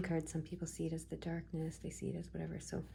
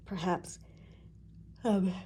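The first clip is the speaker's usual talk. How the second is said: words through a yawn; 5 dB louder than usual. Real, not acted. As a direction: talk mid-yawn. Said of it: "I don't know why I'm yawning".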